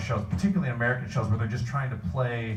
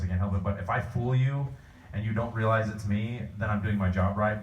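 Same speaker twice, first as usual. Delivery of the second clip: usual speaking voice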